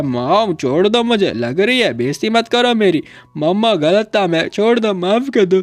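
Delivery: lightly acted tearful son; mock crying plea. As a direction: whimper an apology to mom, stretched out. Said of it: "बंदा रोए जा रहा होता है".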